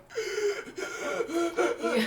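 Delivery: high, strained gasps for air, imitated; hammed-up breathless squeaky gasping; winded wheezing. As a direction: wheeze like the wind got knocked out of you. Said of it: "it knocked the air out of me".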